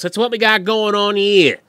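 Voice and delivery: put-on Boston accent. Wrapping up with his catchphrase, hammed-up Boston accent.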